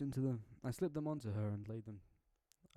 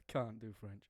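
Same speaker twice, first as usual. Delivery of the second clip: usual speaking voice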